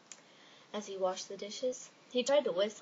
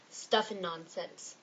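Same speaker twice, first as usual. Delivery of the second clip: slow grumpy; 2 semitones lower, slower than usual. Acted. Wiping off cat kiss.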